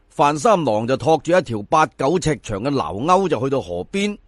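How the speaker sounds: plain reading voice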